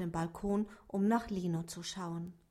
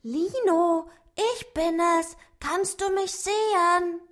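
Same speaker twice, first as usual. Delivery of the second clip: higher, louder little dwarf voice; calling louder; 9 semitones higher, 8 dB louder than usual. A narrator's reading. Dwarf Otto calls louder to the rabbit Lino, higher. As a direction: call out to him a bit louder. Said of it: "rief jetzt etwas lauter".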